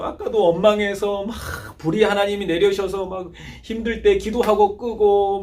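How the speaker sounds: ordinary delivery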